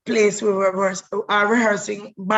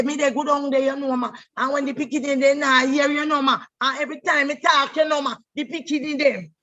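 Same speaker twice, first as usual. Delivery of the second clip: lightly voiced Jamaican country patois, a bit higher; Lynn's country patois; 5 semitones higher, faster than usual. Imitating how Lynn talked, in Jamaican country patois. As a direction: slip into Lynn's broad Jamaican country speech. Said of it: "she would talk like this".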